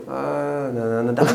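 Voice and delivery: imitation of speaking in slow motion. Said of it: "E agora assim, devagar".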